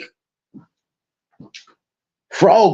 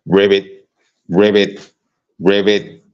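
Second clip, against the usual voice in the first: croaking ribbit in a lower voice; mock frog croak; 5 semitones lower, slower than usual. Real, not acted. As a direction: taunt her with a frog croak. Mocking her as frog.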